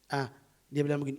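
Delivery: ordinary talk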